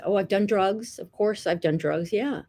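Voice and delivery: normal talking voice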